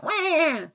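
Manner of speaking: high fake baby wail; a bratty mock cry; squealed fake whine. Mock cry at Instagram comment.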